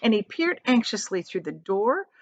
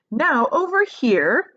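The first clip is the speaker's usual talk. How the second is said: slow, louder, eager call; excited lead-on; 6 semitones higher, 6 dB louder, slower than usual. A narrator's reading. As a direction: beckon them over with glee. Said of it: "skipping excitedly across the room".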